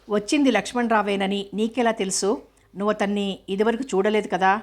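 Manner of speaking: usual speaking voice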